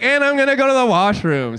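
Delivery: mock stage announcement. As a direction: imitate a performer calling a break. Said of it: "middle of your set, you're like".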